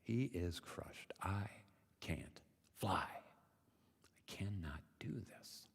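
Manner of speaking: slow, hushed defeat; halting words, sinking into a soft near-whisper; crushed. Acted. Frog gives up on flying, crushed and quiet. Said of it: "He's crushed".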